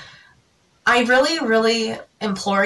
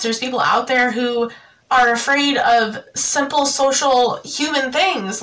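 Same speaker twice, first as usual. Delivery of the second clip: ordinary talk, nothing marked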